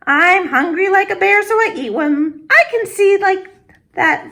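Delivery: put-on high, bright old grandmother voice; old woman greeting a visitor at her door; Baba Brina greeting. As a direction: welcome the guest like a kind old granny.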